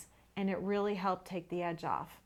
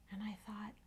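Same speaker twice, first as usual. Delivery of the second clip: whispered aside; 11 dB quieter than usual. Real, not acted. Whispered lead-in to thought.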